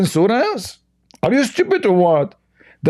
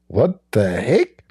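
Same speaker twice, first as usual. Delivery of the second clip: disbelief; 8 semitones lower, slower than usual. Real, not acted. Reacting to "ten times more.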